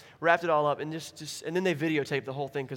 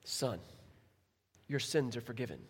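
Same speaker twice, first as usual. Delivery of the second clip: low, soft, gentle pronouncement; quiet Jesus; 6 semitones lower, 10 dB quieter than usual. Acted. Jesus speaking softly to the paralyzed man. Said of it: "And Jesus goes".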